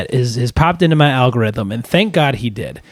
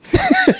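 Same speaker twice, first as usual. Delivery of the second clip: high laugh. Real, not acted. Laughing at the story.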